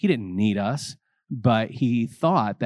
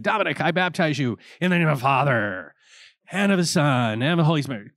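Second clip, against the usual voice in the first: raised, ringing priestly baptism formula; priest baptizing; 5 semitones higher than usual. Retold or imitated. The priest calls out the baptism words to the newcomer. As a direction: intone it like a priest at the font. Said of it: "And he'd say".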